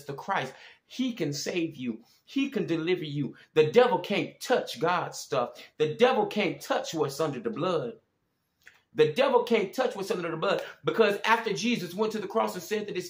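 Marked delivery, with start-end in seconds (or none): none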